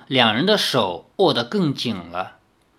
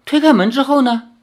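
puzzled demon; 11 semitones higher, 6 dB louder, faster than usual. Acted.

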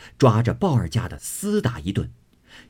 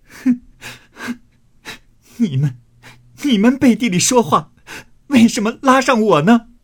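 high, loud sobbing protest; sob, stammer the start, then wail the grievance; crying protest; 13 semitones higher, 5 dB louder than usual. Acted. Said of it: "哭道". (委屈地哭喊，抽泣着质问)

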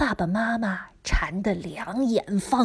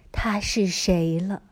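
ordinary delivery, nothing marked

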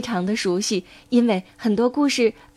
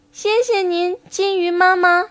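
put-on high, childlike thanks; little tadpoles; 7 semitones higher than usual. Tadpoles thanking mother duck, high little voices. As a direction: thank her in a small high voice.

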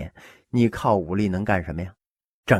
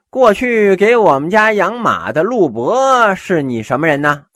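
haughty question; 9 semitones higher, 6 dB louder than usual. Acted. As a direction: look down on him as you ask. Haughty host questions guest.

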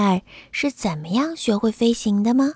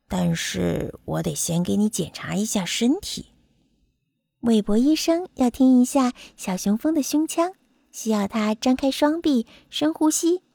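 kindly doctor; 2 semitones lower than usual. Acted. Doctor speaks to young bumblebee.

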